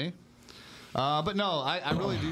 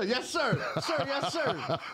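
laughing mock recruit reply; laughing yes sir; 10 semitones higher, much slower than usual. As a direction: giggle through a mock salute.

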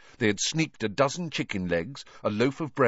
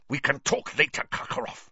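spitting; 6 semitones higher than usual. Acted. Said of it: "spat Snape".

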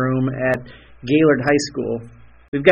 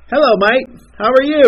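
high, louder mock British accent, drifting Australian; attempted British accent; 9 semitones higher, 4 dB louder than usual. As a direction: put on a British accent that comes out more Australian. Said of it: "he's got a British accent".

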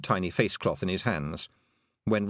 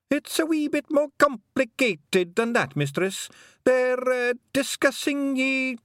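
high, hesitant Scots pixie voice; embarrassed pixie; 4 dB louder, slower than usual. A narrator's reading. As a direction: squirm out of answering, wee pixie. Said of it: "didn't want to be standing there".

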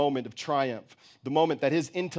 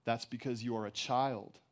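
soft, unirritated answer; calm, cutting; 3 semitones lower, 8 dB quieter than usual. A narrator's reading. Mother to her shouting child, calm but cutting. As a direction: say the hard truth quietly, without irritation. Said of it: "No irritation, but the words still cut".

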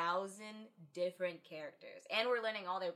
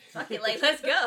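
louder, higher rallying call; gung-ho retold agreement; 5 semitones higher, 14 dB louder than usual. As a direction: imitate someone suddenly all in. Retelling doctor eagerly agreeing to go.

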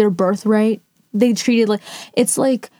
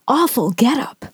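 ordinary talk

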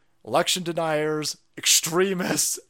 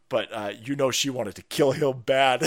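ordinary talk, nothing marked

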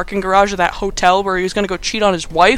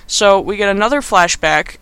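ordinary talk, nothing marked

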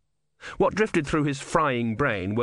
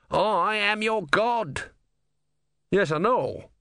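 indignant small god; 10 semitones higher, slower than usual. A narrator's reading. Om and Brother argue.

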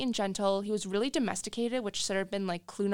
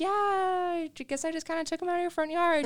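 sheepish; 9 semitones higher than usual. Retold or imitated. Sheepish confession to owners.

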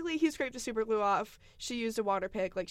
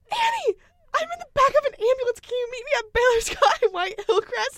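imitating frantic friend; 12 semitones higher, 9 dB louder than usual. Friend calling from the ambulance.

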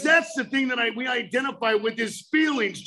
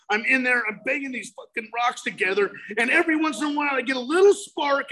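ordinary talk

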